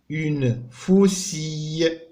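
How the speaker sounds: اون فوسي said slowly, فوسي stressed; teacher modelling French; slow model word. Shown as a demonstration. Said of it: "الصوت اللي كيتكرر هو اي".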